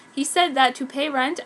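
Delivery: usual speaking voice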